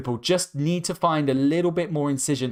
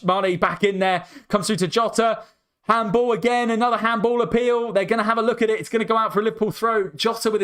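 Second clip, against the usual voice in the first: excited commentary; 8 semitones higher than usual. Real, not acted. Handball appeal in Liverpool attack.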